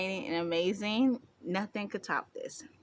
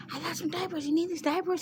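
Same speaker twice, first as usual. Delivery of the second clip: fussing mom; 10 semitones higher than usual. Retold or imitated. Mom offering diapers.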